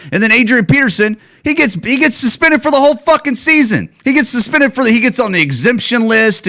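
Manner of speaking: pitched high, hammering the unfairness; outraged rant. Real, not acted. Complaining that Peterson got suspended, high and outraged. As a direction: rise up high and press every word.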